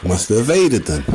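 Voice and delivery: ordinary delivery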